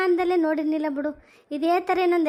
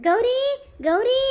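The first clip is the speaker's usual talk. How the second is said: put-on old mother calling higher and louder for Gowri; 6 semitones higher, 3 dB louder, much slower than usual. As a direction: holler the name down the hall. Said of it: "ಇಷ್ಟೊಂದು ಜೋರಾಗಿ ಕೂಗ್ತಾ ಇದ್ದೀನಿ".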